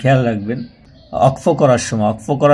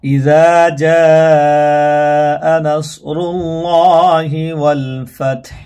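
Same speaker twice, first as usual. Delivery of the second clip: slow, drawn-out tajweed chant; Quran recitation; 2 semitones higher, much slower than usual. Shown as a demonstration. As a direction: recite the sura title slowly, melodically. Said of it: "বানান করে।".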